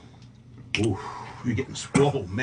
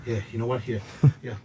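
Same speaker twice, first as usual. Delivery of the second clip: ordinary talk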